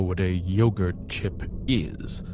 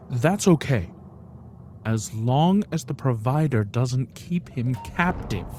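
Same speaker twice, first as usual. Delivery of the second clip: usual speaking voice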